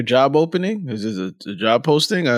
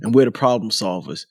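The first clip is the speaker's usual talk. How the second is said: ordinary talk, nothing marked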